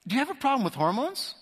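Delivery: taunting question from a bored officer; mocking officer. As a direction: needle him like a smirking soldier. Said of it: "some other guy piped up and said".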